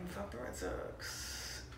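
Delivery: conspiratorial hushed jab at the Red Sox; whispered curse. Real, not acted. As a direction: let the swear slip out under your breath. Rival-team curse, held back.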